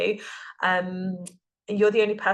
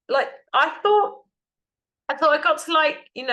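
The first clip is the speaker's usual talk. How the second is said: overwhelmed outburst; 8 semitones higher, 6 dB louder than usual. Real, not acted. Overwhelmed, reaching her limit.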